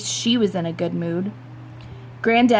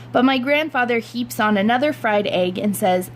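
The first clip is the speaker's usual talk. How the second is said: plain reading voice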